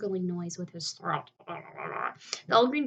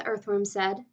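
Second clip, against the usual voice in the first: ordinary delivery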